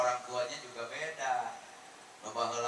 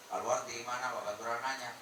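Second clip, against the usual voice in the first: normal talking voice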